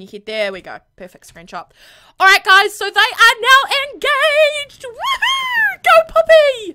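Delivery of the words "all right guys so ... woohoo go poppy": very high, loud whoops; cheering. Real, not acted. Gamer cheers the newly engaged couple, high and loud. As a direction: cheer like at a wedding.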